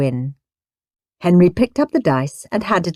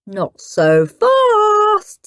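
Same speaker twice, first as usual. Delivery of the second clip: smug; much slower than usual. A narrator's reading. Henry reveals hidden treasure.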